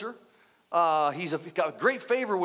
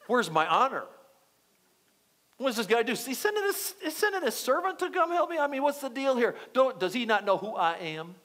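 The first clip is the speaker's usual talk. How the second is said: mock indignation climbing high, then settling; aggravated Naaman; 5 semitones higher than usual. Important soldier complains the prophet only sent a servant, acted aggravated. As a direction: play the offended big shot, voice jumping up. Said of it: "Now, Naaman's really aggravated".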